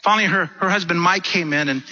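ordinary talk, nothing marked

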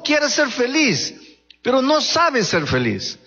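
fervent preacher at full force; impassioned preaching. Acted. Preacher exhorting parents' example.

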